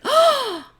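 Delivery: high gasping oh of shock; horrified realization. Retold or imitated. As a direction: gasp out a sudden horrified oh. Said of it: "she's like".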